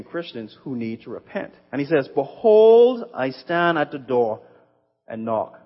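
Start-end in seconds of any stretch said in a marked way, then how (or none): 2.1-5.6 ringing proclamation. Acted. Preacher proclaims the verse.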